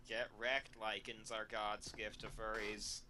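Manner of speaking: ordinary delivery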